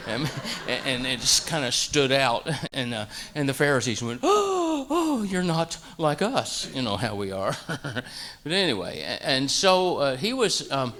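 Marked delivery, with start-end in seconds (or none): 4.2-6.7 much higher mock-haughty "oh", then drawn out slowly; snobbish sneer. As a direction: look down your nose, hammed-up. Pharisees mock Galileans.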